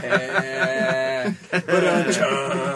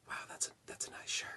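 barely audible whispered compliments; very quiet whisper; 19 dB quieter, faster than usual. Acted. Unseen voice compliments a man at the bar, whispering. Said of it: "real, real quietly".

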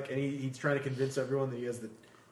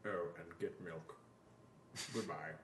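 mock Alan Rickman goodbye, low and quiet; Alan Rickman impression; 8 semitones lower, 16 dB quieter than usual. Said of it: "Hello, Alan".

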